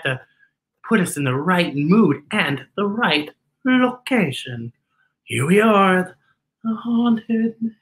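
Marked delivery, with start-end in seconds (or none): none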